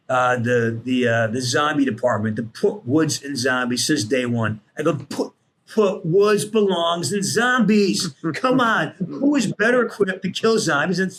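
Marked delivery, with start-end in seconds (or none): 5.0-11.0 retold insistent pleading. Re-enacting his campaign to the developers.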